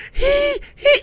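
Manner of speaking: high, squeaky gasps; mock wheezing. Climber struggling up flights of stairs, mock wheezing. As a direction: imitate being winded. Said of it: "I wasn't like".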